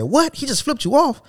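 high shocked disbelief; the manager can't believe what he hears. Retold or imitated. Manager hearing coworker flipped him off, high shocked. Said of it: "He said".

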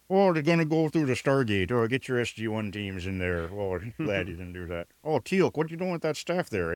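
hammed-up drawling Missouri general; exaggerated Missouri twang, playing the general; mock Missouri accent. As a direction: put on a folksy Missouri accent. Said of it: "did not give him a Missouri accent".

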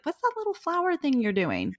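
high-pitched mock-sweet question; condescending acquaintance. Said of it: "she starts asking me about".

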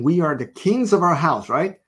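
ordinary talk, nothing marked

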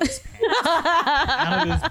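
high, slow laughing words. Real, not acted. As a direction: laugh out the punchline. Cracks up at the bong detail.

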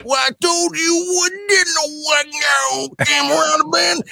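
high, slow, wailing rural gibberish voice; a farmer shouting through a thick mumbling accent, high and slow; mumbling farmer impression. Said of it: "You cannot understand what Gerald's saying".

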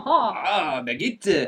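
laughing hammed-up French "baguette"; French accent played for laughs; mock French accent. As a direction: savour "baguette" like a cartoon Frenchman. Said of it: "the French accent".

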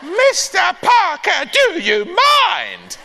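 very high, slow, shouted outrage; mock horror. Granny caught in tree pose, shrieking mock horror at the neighbour. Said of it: "said Granny in mock horror".

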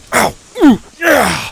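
loud high yelps. A narrator's reading. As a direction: yelp while falling. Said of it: "screamed their brains out".

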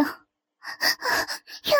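high, tearful wail of pain; high-pitched pained protest; pained crying out. A narrator's reading. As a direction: cry out as if it really hurts. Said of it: "叫死叫活地喊道".

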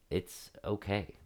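soft, slow and reassuring; gentle reassurance. Retold or imitated. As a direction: say it gently to calm him. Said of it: "And I said it in that tone".

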